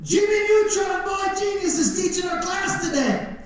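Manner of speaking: mocking guard. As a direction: tease him like a bully guard. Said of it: "They'd be like".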